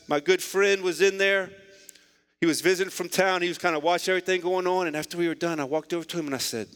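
normal talking voice

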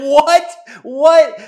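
high, loud, startled double take; shocked what. Retold or imitated. Dad reacts to being told his body is funny, loud shocked yelp. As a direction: shout it like you can't believe your ears.

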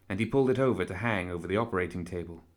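ordinary delivery

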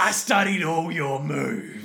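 slow, drawn-out villain boast; hammed-up movie impression, stretching each word; Kano. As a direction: draw it out like a cocky fighter. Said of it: "Kano from the Mortal Kombat movie".

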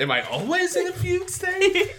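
very high, hammed-up bewildered squeak; loud mock confusion through laughter; clueless Andy. Riffing on Andy's confusion with co-host, high laughing mock panic. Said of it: "he has no clue".

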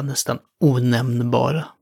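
ordinary talk, nothing marked

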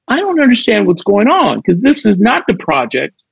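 confused. Retold or imitated. Puzzled actor questioning which project this is, confused. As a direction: voice mild confusion as if replaying it. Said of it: "and I'm confused".

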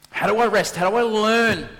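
loud, high, insistent questioning; urgent pleading. Retold or imitated. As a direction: cry out to God for an answer. Acting his plea to God.

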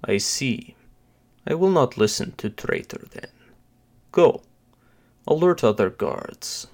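put-on voice of a dutiful henchman; janitor henchman. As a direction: play a janitor henchman giving orders, measured phrases. Janitor Sergei voiced in the story.

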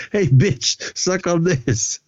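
high, drawn-out imitated jeer; mock taunt. Retelling Steele's insult for laughs, taunting.

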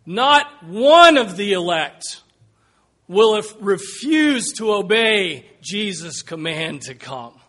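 slow, loud hammering of each word; forceful preaching. Real, not acted. Preacher warning the church, slow and loud. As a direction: drive the point home slowly and loudly.

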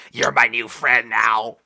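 slow, put-on cartoon voice; cartoon character impression. Showing how the hamster-taker talks, slow cartoon voice. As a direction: draw it out in a creepy cartoon voice. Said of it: "he's just like".

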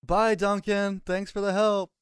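high, sing-song mock thanks; cheeky farewell. Player waving off the hostile guard, cheeky sing-song goodbye. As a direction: chirp it sweetly to needle him.

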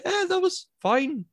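very high, slow, timid mumble; mock a shy director afraid to give notes. Timid producer voiced mockingly.

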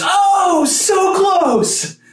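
put-on high, drawn-out boyhood yell; retold yell. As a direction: yell it out high, stretching the words. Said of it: "the phrase we would yell out is".

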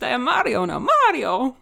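mock Mario accent. Guest hamming a Mario accent to the hosts. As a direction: play Mario in a jokey Italian accent. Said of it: "I don't know why my accent has changed".

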